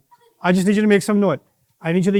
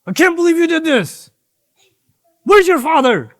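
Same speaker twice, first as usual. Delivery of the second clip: angry yell; 8 semitones higher, 3 dB louder than usual. Shown as a demonstration. Showing the kids how to yell at Abdus Salam in anger. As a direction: demo of an angry crowd, building higher and louder. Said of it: "yell at him like you're angry".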